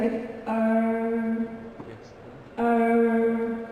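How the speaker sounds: "uh" as a long, level American er; curled-tongue R. Shown as a demonstration. Teacher demonstrates the American R sound, curled-tongue R. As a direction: hold "uh" as a curled R, tongue not touching. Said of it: "I take my tongue back".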